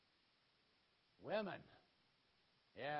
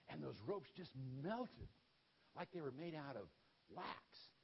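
normal talking voice

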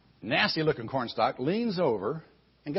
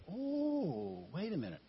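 dawning realization; 9 dB quieter, slower than usual. Retold or imitated. Retold cupbearer suddenly remembers.

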